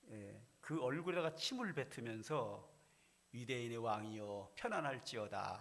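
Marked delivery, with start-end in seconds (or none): none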